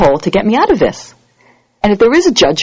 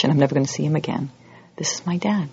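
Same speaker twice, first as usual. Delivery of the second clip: whispered, much softer confession; hushed shame; 3 semitones lower, 7 dB quieter than usual. Acted. Picturing facing his father as judge, whispered shame. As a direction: whisper it like a secret you dread.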